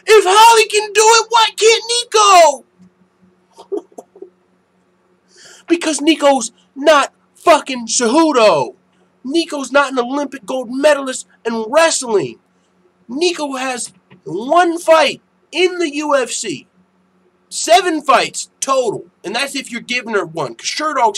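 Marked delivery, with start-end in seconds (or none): 0.1-3.8 high, loud mocking troll voice. Mocking a chat troll's argument, loud and high-pitched. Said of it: "He said". 5.7-19.1 emphatic rebuttal. Real, not acted.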